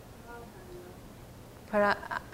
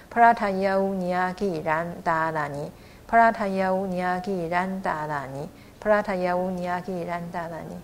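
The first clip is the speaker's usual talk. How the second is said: 帕拉塔耶乌尼亚吉然达拉尼 run together as one even phrase; joined without breaks; 2 semitones lower than usual. Shown as a demonstration. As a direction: read 帕拉塔耶乌尼亚吉然达拉尼 through with no break between words. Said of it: "一句把它合在一起，就不会有断音了". (把“帕拉塔耶乌尼亚吉然达拉尼”连成一句，不断音)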